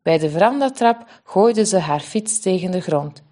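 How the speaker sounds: plain reading voice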